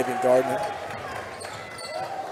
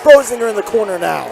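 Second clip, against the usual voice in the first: higher and louder as the play heats up; building excitement; 7 semitones higher, 10 dB louder than usual. Real, not acted. Commentator as the game heats up, rising excited call. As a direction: let the energy climb.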